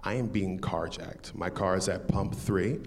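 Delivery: low, measured retelling of a calm request. Retold or imitated. Carjacking victim quietly asks cashier to call police, very calm. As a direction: keep it quiet and level, nothing alarmed. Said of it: "very calmly to the cashier".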